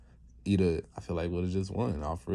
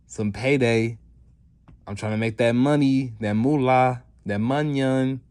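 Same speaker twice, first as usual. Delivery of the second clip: louder, higher hype as villains; mock eagerness; 4 semitones higher, 6 dB louder than usual. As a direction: lightly act out greedy hype, raising the voice. Villains' eager money talk, acted out.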